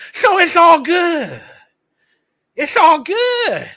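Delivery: slow, high sarcastic drawl. Real, not acted. Host sarcastically shrugging off the man's loss, slow and high. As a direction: sneer it slowly, mock cheerful.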